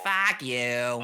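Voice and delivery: loud, broad Boston vowels; hammy Boston tough guy; Boston accent. Acted. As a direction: throw it out like a mock Bostonian. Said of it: "guy from Boston".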